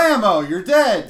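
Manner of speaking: loud and slowed blammo; mock shout. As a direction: bark out the kill sound effect. Acting out a kill.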